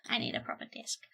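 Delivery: soft unvoiced whisper. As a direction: whisper the same words back. Repeats line, then whispers.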